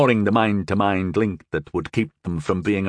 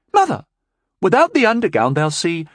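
shocked protest; 10 semitones higher, 5 dB louder than usual. A narrator's reading. Daughter protesting.

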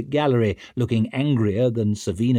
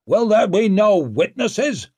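high, loud demand; outraged public challenge; indignant shout; 11 semitones higher, 5 dB louder than usual. A narrator's reading. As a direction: shout the question across a hall. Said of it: "shouted Barrazin".

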